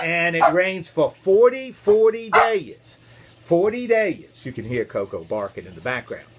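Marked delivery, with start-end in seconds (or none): 0.0-4.4 loud, drawn-out FORTY DAYS; storyteller booming out "forty days" slowly; dramatic emphasis. A narrator's reading. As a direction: punch "forty days" high and loud, stretching it.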